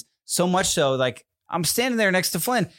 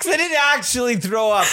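laughing; 9 semitones higher than usual. Real, not acted. Laughing protest.

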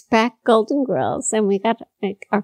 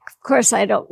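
normal talking voice

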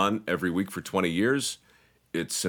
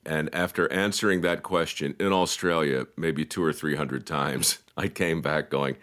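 ordinary talk

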